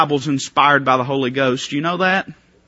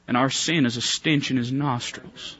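usual speaking voice

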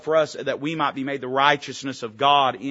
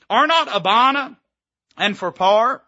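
much higher, louder scornful question; Naaman's boast; 7 semitones higher, 8 dB louder than usual. Retold or imitated. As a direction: scoff at the foreign rivers. Reads Naaman's boast loudly.

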